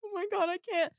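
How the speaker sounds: high, soft near-tearful squeak; verge of tears. Retold or imitated. Fan retelling the wait in line, near tears. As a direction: re-enact being overwhelmed, small high voice. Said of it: "on the verge of tears".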